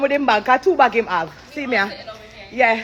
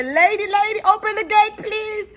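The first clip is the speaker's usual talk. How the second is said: higher, pleading man at the gate; breathless plea; 8 semitones higher than usual. Retold or imitated. Retelling the running man begging the gate agent, higher plea. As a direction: beg to have the gate opened. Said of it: "come run ... and say".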